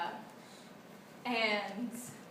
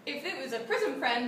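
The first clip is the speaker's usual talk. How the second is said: ordinary talk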